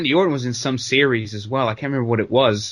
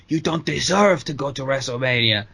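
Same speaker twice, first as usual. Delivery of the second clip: Del Rio. Retold or imitated. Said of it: "Del Rio was like".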